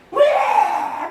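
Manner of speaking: high loud cry of panic; terrified battle scream. Retold or imitated. King Jehoshaphat cornered in battle, screaming. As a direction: scream like a man about to die.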